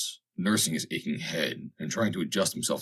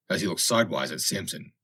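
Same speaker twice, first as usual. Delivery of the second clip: ordinary delivery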